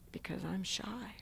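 breathy whispered confession, barely audible; shy whisper. Retold or imitated. Shy man whispers his answer to the pastor. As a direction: whisper it like a shy man who won't talk.

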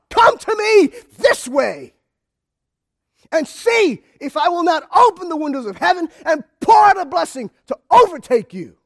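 loud preacher proclaiming God's promise; full-force preaching. Acted. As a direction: build the call like a revival sermon.

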